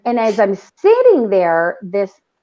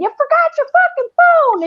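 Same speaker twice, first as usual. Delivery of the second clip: put-on very high-pitched screech of an old lady; mock scream; 4 dB louder than usual. Old lady in emergency room doorway to man, screaming. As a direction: imitate her screaming across the room. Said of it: "she's screaming".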